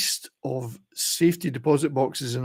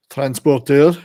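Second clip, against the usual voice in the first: put-on transporteur said louder, in a French-Canadian accent; 9 dB louder, slower than usual. To his viewers, the film title in a playful French-Canadian accent. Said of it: "I'm gonna say it in a French-Canadian accent".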